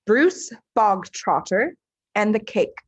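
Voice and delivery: ordinary delivery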